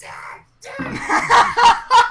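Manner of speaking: put-on very high, loud kid shouting for Dad; child's excited yell. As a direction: yell for your father like an overexcited little boy. GM voices son yelling at father.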